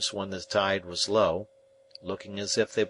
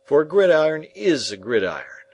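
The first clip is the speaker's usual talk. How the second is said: louder, higher, pressing on gridiron; steadfast insistence; 7 semitones higher, 8 dB louder than usual. Acted. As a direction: drive the point home. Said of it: "steadfastly impressing it upon me".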